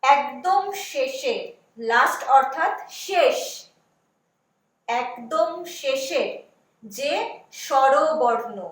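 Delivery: put-on slow, deliberate words for children; slow teacher dictation. Shown as a demonstration. Teacher dictating slowly to young pupils. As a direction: spell it out slowly so pupils follow. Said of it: "जो स्वर वर्ण है".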